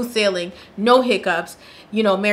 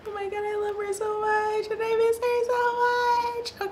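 higher and much softer, near tears; tearful missing friend; 10 semitones higher, 10 dB quieter than usual. Real, not acted. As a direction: choke up softly over a missed friend. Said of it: "we're not gonna cry over her".

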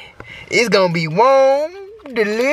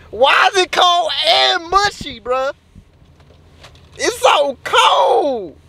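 whining complaint; 8 semitones higher, slower than usual. Acted. Whining about cold mushy food.

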